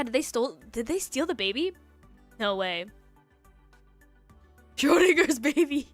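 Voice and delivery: usual speaking voice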